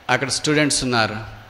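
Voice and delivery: usual speaking voice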